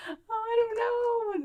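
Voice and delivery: high, slow, whiny hesitation; hammed-up fearful whine; mock whimper. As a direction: whimper like you're too scared to jump. Scared at cliff edge.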